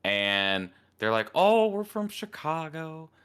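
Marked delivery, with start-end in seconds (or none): 1.4-3.1 high, slow quoted woman's voice; imitated lady customer. Lady customers answering where they are from, high imitation. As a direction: put her answer up in a high, drawn-out voice.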